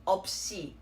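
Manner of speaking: 없이 joined up as op-shi; op-shi without a long pause; second consonant carried. Shown as a demonstration. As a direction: say 없이 as one word, p then shi. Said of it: "this would be pronounced as".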